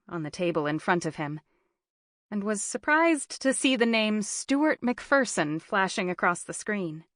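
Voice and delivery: plain reading voice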